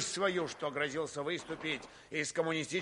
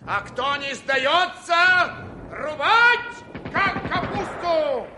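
rising roar to charge; hoarse battle cry; 12 semitones higher, 8 dB louder, much slower than usual. Acted. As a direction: bellow the order to attack. Commander rallies troops to attack. (хриплый боевой клич)

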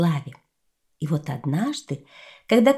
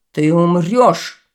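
menacing threat; 6 dB louder, slower than usual. Acted. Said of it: "сказал он".

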